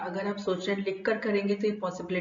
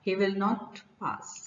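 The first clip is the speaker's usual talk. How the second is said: "he will not pass" slow, dropping low on "not pass"; slow low falling result; much slower than usual. Shown as a demonstration. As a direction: let the consequence fall flat to the end. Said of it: "not pass बोल रहे हैं तो it should be in low pitch".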